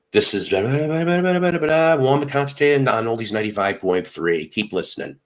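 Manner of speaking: imitating an on-air contest plug; radio winner promo. Contest winner recording his spot for the station, in radio promo voice. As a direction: swoop up high on the blahs, then settle into the sign-off. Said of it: "You been in radio?".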